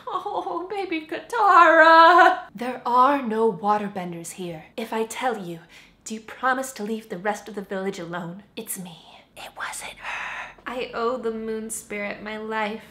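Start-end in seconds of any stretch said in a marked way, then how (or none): 0.1-2.4 put-on high, cooing to a baby; cooing baby talk. 6.0-10.5 whispering. Acted. Whispered confession scene.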